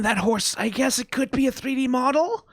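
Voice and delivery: ordinary talk, nothing marked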